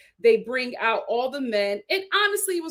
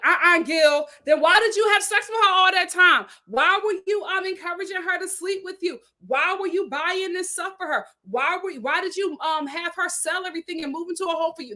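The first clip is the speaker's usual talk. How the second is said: mock outraged grilling; 6 semitones higher than usual. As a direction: grill him like an angry fan. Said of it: "we would all be like".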